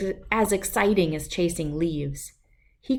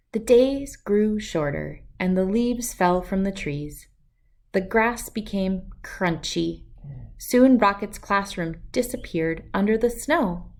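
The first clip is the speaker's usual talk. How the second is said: plain reading voice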